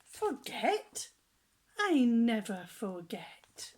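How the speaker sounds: loud, slow proud call. Acted. Sam calls out to the hungry elephant, loud and proud. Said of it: "Sam calls out".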